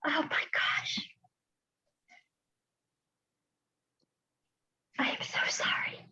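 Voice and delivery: soft whispered apology through real tears; crying whisper. Real, not acted. Leader apologizing to her team, whispering while crying. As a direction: barely get the words out, crying. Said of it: "forgive me for my emotions tonight".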